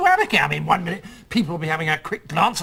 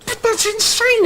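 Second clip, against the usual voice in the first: indignant; 14 semitones higher, 4 dB louder, much slower than usual. Acted. Outraged at the rule.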